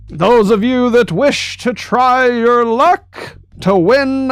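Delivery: carnival barker. Acted. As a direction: call out to passersby like a barker. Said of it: "he is yelling to the various passenger passing bys".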